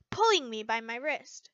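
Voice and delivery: ordinary delivery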